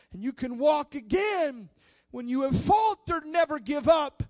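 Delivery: loud, high, driving sermon voice; preaching at full force. Acted. Preacher urging the congregation not to give up, loud and fervent. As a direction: preach it out to the whole church, building and shouting.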